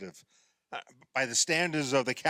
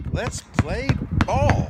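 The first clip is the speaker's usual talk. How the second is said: excited call; 9 semitones higher, 8 dB louder, slower than usual. Acted. Gleeful start of playground game.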